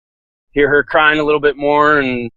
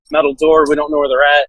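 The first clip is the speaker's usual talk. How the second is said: normal talking voice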